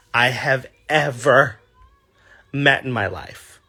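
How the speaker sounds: ordinary delivery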